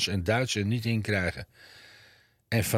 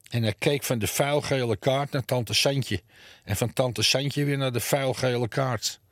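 usual speaking voice

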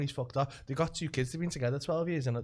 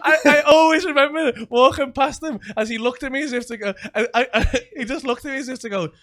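laughing; 9 semitones higher, 11 dB louder than usual. Real, not acted. Laughing while retelling.

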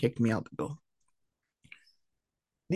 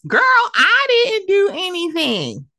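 high, loud, drawn-out imitation of a friend's denial; mock protest; 14 semitones higher, 5 dB louder, slower than usual. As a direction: squeal the modest denial, stretching it out. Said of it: "I know she's like".

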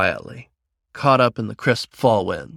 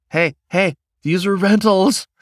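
alarmed protest; 8 semitones higher, 4 dB louder than usual. Acted. Protesting claws on rented suit.

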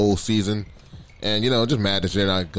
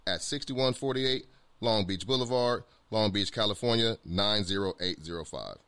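ad read; 3 semitones higher, 5 dB quieter than usual. Acted. Reads a sponsor ad.

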